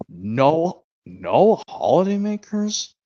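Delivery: slow, drawn-out moan; mild moan. A narrator's reading. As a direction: moan it slowly in mock dismay. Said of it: "moaned Haas".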